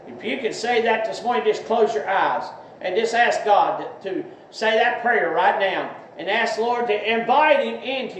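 earnest spoken prayer, leading others. Acted. Preacher voicing the sinner's prayer for listeners, earnest prayer. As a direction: pray it out for them to repeat.

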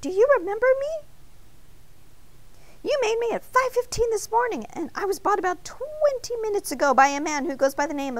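high-pitched, urgent introduction; frantic. A narrator's reading. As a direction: plead to be recognized, pitch way up. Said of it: "Arnie frantically whispered".

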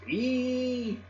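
very high, held squeal; scream. A narrator's reading. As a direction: let out a thin, piercing "eee". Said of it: "It was a scream".

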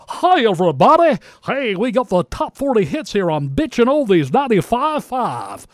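over-the-top carnival barker DJ; old-school radio DJ. Retold or imitated. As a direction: ham up a sixties radio barker. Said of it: "everybody on radio sounded just like him".

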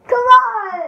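high excited yell; loud shout. Real, not acted. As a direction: shout it out, urging. Urging cousin during challenge.

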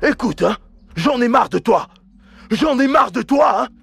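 a husband shouting his wife out of the house, furious; angry rant. Acted. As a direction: lash out at her, building to a shout.

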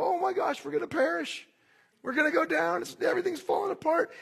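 the Israelites wailing that all is lost, imitated; panicking. Preacher imitating the panicking Israelites, hammed-up panic. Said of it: "and they go".

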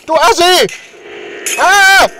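loud, high yell; shouting. Acted. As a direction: yell it in outrage. Yelling at the neighbor.

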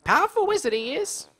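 put-on high, rustic old hermit. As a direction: play the chatty hermit with a country twang. Hermit voice continues.

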